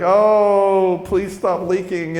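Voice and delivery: half-joking helpless begging; pleading. Acted. Man helpless when girls cry, pleading.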